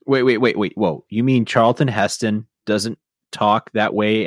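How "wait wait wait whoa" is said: ordinary talk, nothing marked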